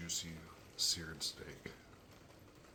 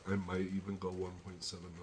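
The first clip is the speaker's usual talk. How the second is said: ordinary talk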